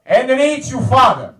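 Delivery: put-on loud, high Italian-accented dad on the phone; Italian father accent. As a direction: announce yourself like an Italian father. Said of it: "you know how Italian parents are".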